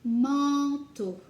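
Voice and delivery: loud model of Manteau; called out word. Shown as a demonstration. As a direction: call Manteau out clearly. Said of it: "teacher word drill".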